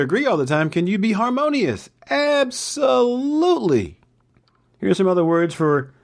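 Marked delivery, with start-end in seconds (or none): 2.1-4.0 emphatic yes. Real, not acted. Enthusiastic answer.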